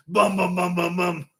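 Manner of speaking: rhythmic high-pitched vocal gunshots; imitated gunfire. Outback man firing his rifle, imitated gunfire. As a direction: mimic an automatic rifle with your mouth.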